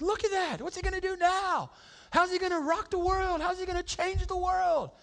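excited disciples. Retold or imitated. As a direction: wonder aloud what Jesus will do next.

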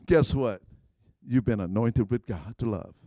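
usual speaking voice

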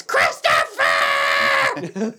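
monstrous drawn-out mock roar; hammed-up bellow in a distorted voice; angry morning mom. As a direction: imitate mom roaring the name, stretched long. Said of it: "But in the morning".

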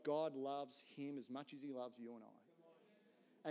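slow down and mean every word; gentle earnest reassurance; sincere and tender. Real, not acted. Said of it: "can I just say".

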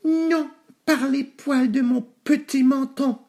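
very high, slow squeaky pig; piglet refusing. A narrator's reading. Nouf Nouf answering the wolf, high and deliberate. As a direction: refuse slowly in a tiny high voice. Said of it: "répondit Nouf Nouf".

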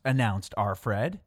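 normal talking voice

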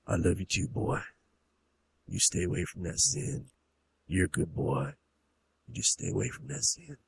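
low, soft fatherly warning; acted hushed reconciliation; tender stern father. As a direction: gently admonish the boy. Father forgives and warns son.

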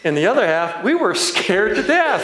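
words bubbling up through laughter; real laughter as the story lands; laughing. Real, not acted. Laughing at the memory.

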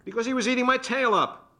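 indignant. Acted. Couple quarrel.

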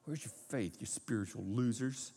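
soft, scornful put-down; contemptuous sneer. Shown as a demonstration. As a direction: sneer down at them like failures. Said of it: "you see a finger pointing".

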